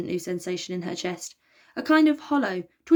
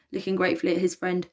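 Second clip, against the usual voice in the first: ordinary delivery, nothing marked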